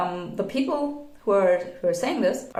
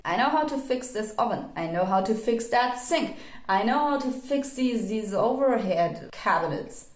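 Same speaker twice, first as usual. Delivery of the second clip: ordinary talk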